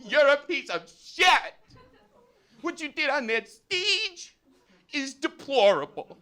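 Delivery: high, slow, outraged yelling; hammed-up shrieked indignation; mock furious scream. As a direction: imitate a furious woman spacing out every phrase. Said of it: "the woman is just furious at me".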